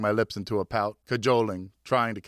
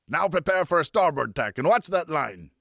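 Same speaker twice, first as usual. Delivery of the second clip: put-on higher, louder old sailor calling the tack; riverman's orders; 5 semitones higher, 3 dB louder than usual. A narrator's reading. Francois ordering the boy to tack, firm and salty. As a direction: bark the order across the boat.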